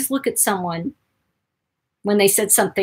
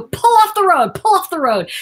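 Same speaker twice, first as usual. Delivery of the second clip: shouted order to pull over; yelling; 9 semitones higher, 5 dB louder than usual. Retold or imitated. Speaker in the car yelling at her friend to pull over. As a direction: yell at him to stop the car. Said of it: "I go".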